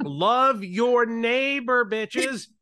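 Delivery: loud and slow, every word drawn out. Pretend. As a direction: preach it loud to the back row. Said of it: "Let me speak louder for the people in the back".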